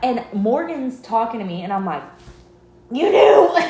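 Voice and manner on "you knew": high, loud "You knew."; accusing friend who already knew, loud and high; shouted accusation. Retold or imitated. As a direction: yell it at the friend who kept the secret.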